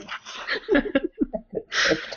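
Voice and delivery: breathy high burst of real laughter; laughing. Real, not acted. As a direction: crack up at your own joke. Cracking up at own impression.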